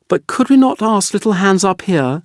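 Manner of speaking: put-on a small boy pleading for his friend; the youngest son. A narrator's reading.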